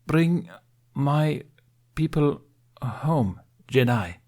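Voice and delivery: each word set apart by pauses, pitch lifted; slow, effortful words from a proud captain; halted, forced Basic. A narrator's reading. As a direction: push the words out haltingly in a language not your own. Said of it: "in very halted and forced basic".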